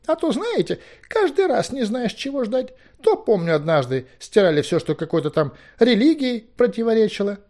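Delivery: high, fussy figure reminiscing; high-pitched relieved chatter, then shooing; relieved, rambling Merlin. A narrator's reading. Merlin recalls old bans to Owen, relieved and rambling.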